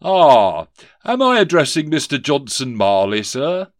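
a lightly voiced toff asking politely; pompous gentleman. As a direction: collector addressing the office owner. Rees-Mogg greets Scrooge.

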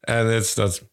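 usual speaking voice

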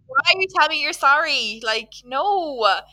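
baffled, emphatic objection; incredulous protest. Acted. Acting exasperated reply to apologiser.

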